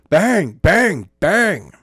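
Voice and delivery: three loud spoken thuds; loud voiced bangs. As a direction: slam out each bang. Narrator voices tapping out.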